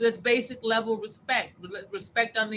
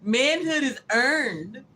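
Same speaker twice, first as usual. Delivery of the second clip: slow mock slogan; 4 semitones higher, 4 dB louder, much slower than usual. Quoting a slogan slowly.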